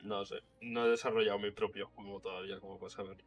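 normal talking voice

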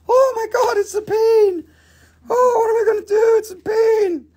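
very high, loud mock panic shriek. A seller shrieks in mock horror at a peen. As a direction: squeal in pretend horror at the peen.

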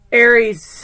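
loud, bold call of the sign; announcing loudly. Real, not acted. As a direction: call out the name like a host.

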